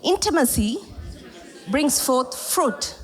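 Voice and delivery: very slow, split after "Intimacy"; call and response. Shown as a demonstration. Preacher drills the phrase slowly with the congregation. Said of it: "Can you say it with me".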